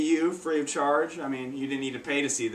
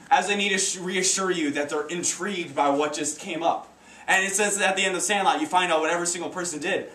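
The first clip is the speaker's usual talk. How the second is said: ordinary delivery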